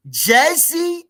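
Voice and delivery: high, loud drawn-out call; hype shout-out. Real, not acted. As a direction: call it out big for the crowd. Shouting out his viewer's home turf.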